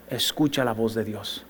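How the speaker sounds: low, soft, slow; intimate appeal. Real, not acted. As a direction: draw them in quietly. Pastor's closing appeal. (llamado íntimo, lento y suave)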